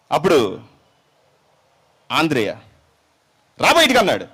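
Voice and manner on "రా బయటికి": loud, high command to the demon; commanding shout. Acted. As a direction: bark the order out. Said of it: "గిరిపించే అంత అరిచాడు".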